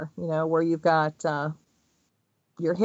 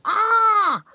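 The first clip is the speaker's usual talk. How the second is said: sudden high loud frazzled scream; frantic scream; 13 semitones higher, 6 dB louder than usual. Retold or imitated. Nanny answering the phone over yelling kids, frazzled scream. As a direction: yelp it out like a nanny at her wits' end. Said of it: "And she goes".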